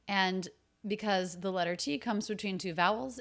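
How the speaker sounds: usual speaking voice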